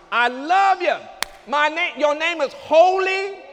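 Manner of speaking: climbing shout of testimony, high and loud; preaching at full force. Real, not acted. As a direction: cry out the choice to serve God.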